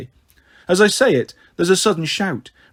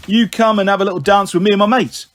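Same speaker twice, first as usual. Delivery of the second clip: put-on loud, higher-pitched drunken lad; pushy skinhead; 4 semitones higher, 5 dB louder than usual. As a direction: press her insistently, as a swaggering lout. Skinhead pressures the narrator.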